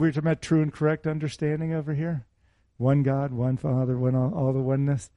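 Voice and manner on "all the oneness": ordinary talk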